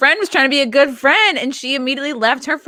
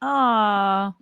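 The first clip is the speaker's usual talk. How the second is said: gushing; much slower than usual. Real, not acted. Gushing over wedding story.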